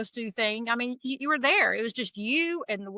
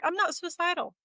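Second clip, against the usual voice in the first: higher-pitched retold protest; reassuring someone in a raised pitch; insistent denial; 9 semitones higher than usual. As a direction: insist earnestly, pitch jumping up. Insisting to counselor she's not suicidal.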